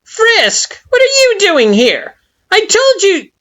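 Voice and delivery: very high, loud, mocking flower voice; derisive Flowey. A narrator's reading. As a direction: sneer it in a tiny high voice. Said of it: "high-pitched and laced with derision".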